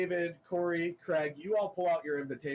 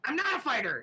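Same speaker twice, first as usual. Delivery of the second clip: high, loud cry of refusal; panicked protest; 8 semitones higher, 3 dB louder than usual. Acted. Scared character refusing to fight, high and loud. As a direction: yelp it before slamming the door. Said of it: "and he goes".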